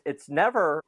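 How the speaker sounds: normal talking voice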